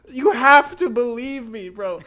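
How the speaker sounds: loud, high, drawn-out insisting; frantic mock plea. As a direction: beg your cousin at full volume. Retold pleading with cousin.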